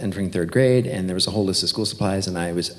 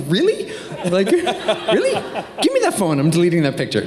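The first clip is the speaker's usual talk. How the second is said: mock outrage; 9 semitones higher than usual.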